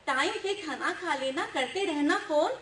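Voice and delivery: projected verse with drawn-out English punchline; humorous poem recital. Acted. Recites poem, voicing mother and child. (हास्य कविता-पाठ)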